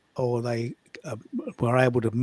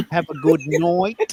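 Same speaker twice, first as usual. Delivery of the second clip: drawn-out Melbourne "night", like "noit"; slightly hammed-up Melbourne vowels; Melbourne accent; 2 semitones higher, slower than usual. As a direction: sign off in playful, exaggerated Melbourne. Jokingly using the demonstrated accent.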